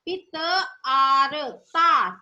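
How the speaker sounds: very slow, sounding out each syllable; slow syllable blending. Shown as a demonstration. Teacher sounding out syllables slowly for young pupils. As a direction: build the word piece by piece, slowly. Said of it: "तो क्या बन गया".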